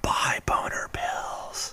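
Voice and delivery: breathy slow whisper of an ad pitch; slow hushed sales whisper. Pretend. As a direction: whisper it like a shady secret. Mock ad aside.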